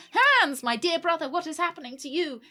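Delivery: high, loud melodramatic cry; anguished plea. Acted. Sister crying out to her stricken brother, melodramatic anguish. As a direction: call out in hammed-up distress.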